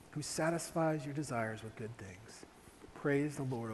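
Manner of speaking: whispered, slowing prayer; hushed psalm. Acted. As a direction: breathe the words like a secret prayer. Psalm read as a hush.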